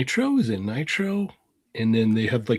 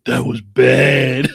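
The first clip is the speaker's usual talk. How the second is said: slow, loud stunned praise; slow loud amazed that was bad; awed kid; 2 semitones higher, 8 dB louder, much slower than usual. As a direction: drag out the awe like a blown-away kid. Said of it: "And I was all".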